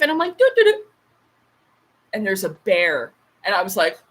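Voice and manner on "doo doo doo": high, loud "doo doo doo"; playful high-pitched doo-doo sounds; imitated tiptoeing noise. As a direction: voice your own jaunty entry into the cave. Acts creeping into a cave.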